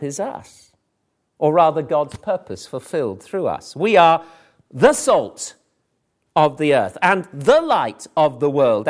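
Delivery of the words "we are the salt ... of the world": "the" set apart and weighted before "salt" and "light"; pause, then lean on each "the"; deliberate emphasis on the article "the"; stress on "the". Shown as a demonstration. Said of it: "putting an emphasis on the article".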